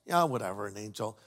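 low, offhand shrug of a line; dismissive brush-off. Retold or imitated. Mary brushing off the angel, low and dismissive. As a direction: wave the angel away, unimpressed. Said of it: "you would think she'd say".

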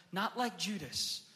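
ordinary talk

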